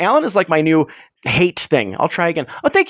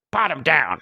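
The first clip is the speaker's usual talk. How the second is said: gruff friend; 4 dB quieter, slower than usual. Retold or imitated. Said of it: "He's like".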